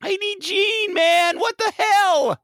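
high, frustrated whine; fed up with the runaround; exasperated caller. Pretend. Frantic caller gets exasperated.

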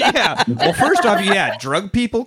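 high, slow mocking disbelief. Real, not acted. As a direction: scoff at the phrase. Mocking the line.

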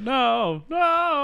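high, wailing imitated protest; hammed-up panicked refusal; acted panic. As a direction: cry out in mock horror. Acted panic on seeing the devil.

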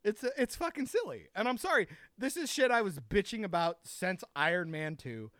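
ordinary delivery

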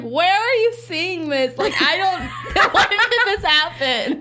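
high, slow, laughing "when did this happen?"; laughing confusion. Retold or imitated. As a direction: laugh through the baffled question. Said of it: "I was like".

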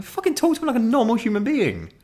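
high, fed-up telling-off to himself; exasperated self-scolding. Retold or imitated. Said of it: "I'm like".

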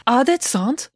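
high, anxious Swedish question; shocked question in Swedish. A narrator's reading. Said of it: "remembering to speak Swedish".

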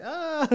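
high, loud "ah" of mock panic; hammed-up startled scream; mock panicked cry. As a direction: yelp as if suddenly panicking, played for laughs. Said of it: "I started panicking".

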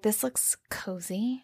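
a hint of cold jealousy; lightly voiced menace. Jealous captor to the man in the hot tub, quiet sarcastic jab.

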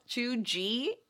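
"chew G" said softly, as two separate syllables; pronouncing cheugy. Shown as a demonstration. Host explains her "chew G" pronunciation to her co-host. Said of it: "when you say G E E".

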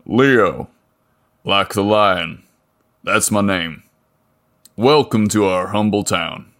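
a lightly voiced burly mechanic, welcoming; friendly big mechanic. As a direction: greet him like a friendly small-town giant.

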